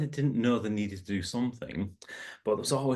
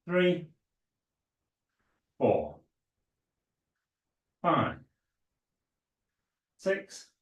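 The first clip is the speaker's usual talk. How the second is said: aerobics instructor; 2 semitones higher, slower than usual. Acted. Instructor counting glute kicks.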